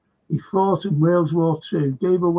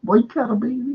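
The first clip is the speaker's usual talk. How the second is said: a hint of a Jamaican accent, pitched higher; faint Jamaican accent, higher pitch; light Jamaican accent; 7 semitones higher than usual. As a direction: quote the man in a mild Jamaican accent. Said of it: "he had this Jamaican accent".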